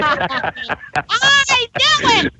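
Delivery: high, drawn-out gloating delight; gleeful triumph. Real, not acted. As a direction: stretch it out, thrilled you guessed right. Hunch about Texas accent confirmed.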